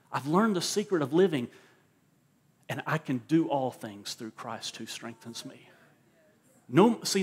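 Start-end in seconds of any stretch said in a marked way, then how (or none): none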